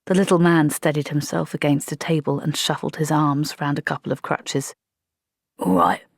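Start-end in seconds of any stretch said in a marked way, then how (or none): 5.6-6.0 put-on a short gruff old soldier. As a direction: bark it out gruffly. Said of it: "he said gruffly".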